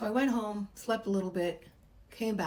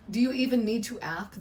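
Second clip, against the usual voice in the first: louder, raised, eager retort; excited friends; 4 semitones higher, 6 dB louder than usual. Retold or imitated. Girlfriends answering the party invite, mildly mock excited. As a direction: retell the friends' thrilled reply. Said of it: "They were so excited".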